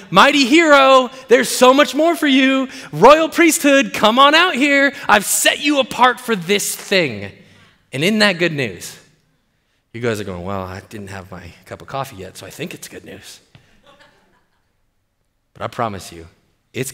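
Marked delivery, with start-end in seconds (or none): 0.1-7.3 high, loud, drawn-out summons; rousing call. Acted. God calling people out of pits. 10.4-13.4 unenthused. Acted. Voices sleepy, unconvinced congregation.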